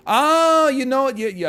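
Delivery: loud, high drawn-out "ah"; mock realization. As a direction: burst out as if it just hit you. Exaggerated sudden concession to chat.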